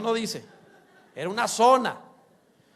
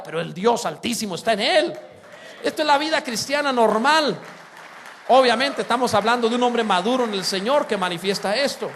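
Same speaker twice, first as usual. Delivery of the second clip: loud declaring sermon voice; full-force preaching; 2 semitones higher, 8 dB louder than usual. Real, not acted. Preacher proclaiming to the congregation in a loud, full voice. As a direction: proclaim it to the whole church.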